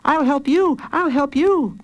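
put-on high child voice; high-pitched kid brother; eager little boy. A narrator's reading. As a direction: offer help as a small boy. Small boy offers help.

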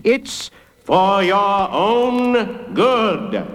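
high, hugely drawn-out chant; crowd calling back. A narrator's reading. Big people answering the judge, calling back slowly. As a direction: stretch every word in a chorus-like reply. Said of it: "called back".

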